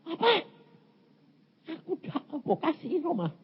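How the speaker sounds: high, soft mock whimper; mock crying. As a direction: whine it high as if in tears. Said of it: "Nangis saudara saya, nangis saya". (pura-pura menangis, suara tinggi merengek)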